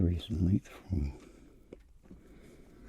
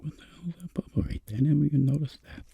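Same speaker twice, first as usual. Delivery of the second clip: Tony voice; 12 semitones higher, 3 dB louder, faster than usual. Pretend. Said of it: "He's like".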